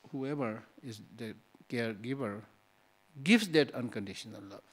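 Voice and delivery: normal talking voice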